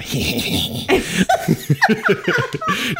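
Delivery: high gremlin cackle; gremlin snicker. Pretend. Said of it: "You just did a really good gremlin smirk".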